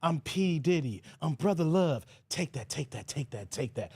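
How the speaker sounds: deep, slow, low mock [name] voice; [name] impression. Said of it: "I'm [name]".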